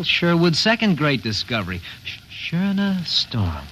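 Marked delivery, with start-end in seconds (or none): 2.5-3.6 slow, drawn out Schöner Storm; savoring the name. Acted. Publicist pitches the new name to the skipper, drawn out. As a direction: present Schöner Storm like a headline.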